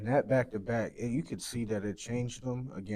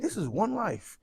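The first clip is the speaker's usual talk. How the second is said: urgent plea; 9 semitones higher, slower than usual. Retold or imitated. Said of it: "I just be like".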